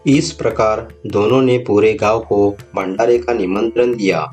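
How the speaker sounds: ordinary delivery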